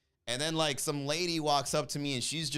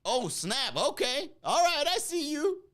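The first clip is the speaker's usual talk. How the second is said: much higher mock surprise; taken aback; 10 semitones higher, slower than usual. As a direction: caught off guard, impressed. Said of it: "She was, like, taken aback".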